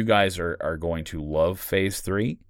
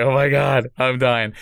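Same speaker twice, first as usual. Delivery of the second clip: higher, louder, laughing gush; mock overwhelmed cry; 7 semitones higher, 8 dB louder than usual. Host recalling a rom-com moment, laughing, higher and louder. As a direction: gush like a rom-com makes you melt. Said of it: "I was just like".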